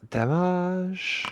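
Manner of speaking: DAMAGE said "da-MAAZH", high and drawn out; mock-French "damage". Player announcing the damage roll, high mock-French "damage". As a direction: stretch "damage" into a high, playful "da-maaazh".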